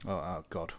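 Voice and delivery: ordinary talk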